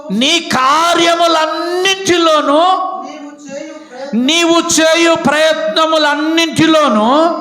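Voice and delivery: high, loud, slow pulpit blessing; full-force preaching. Acted. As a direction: proclaim the promise to the congregation. (పూర్తి శక్తితో ప్రసంగం)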